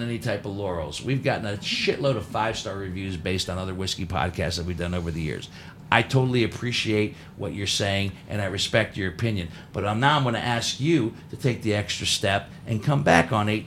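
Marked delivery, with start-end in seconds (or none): none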